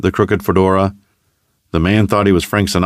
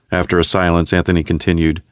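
usual speaking voice

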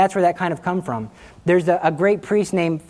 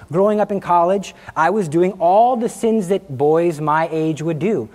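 ordinary talk

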